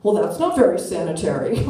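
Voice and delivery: lightly voiced fussy man; snooty customer. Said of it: "this bitch in a scarf says".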